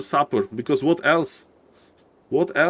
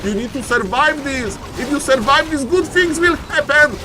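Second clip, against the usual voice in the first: building excitement; 9 semitones higher than usual. Real, not acted. Caster willing a player to survive, rising excitement. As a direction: voice climbing higher line by line.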